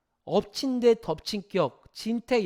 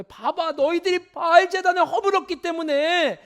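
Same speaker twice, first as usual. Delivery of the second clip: higher, louder taunting jeer with a laugh; mocking crowd; 7 semitones higher, 6 dB louder than usual. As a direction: sneer like the scornful townsfolk. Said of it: "일반 사람들은 뭐라고 생각할까요".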